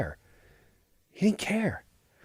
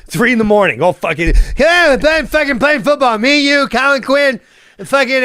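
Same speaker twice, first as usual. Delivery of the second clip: higher, louder pushy bro at 3 a.m; hyped-up bro; 8 semitones higher, 4 dB louder than usual. Retold or imitated. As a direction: yell him into a late-night hallway game. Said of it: "he like".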